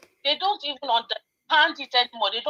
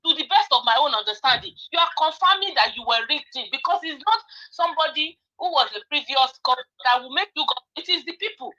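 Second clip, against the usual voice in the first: usual speaking voice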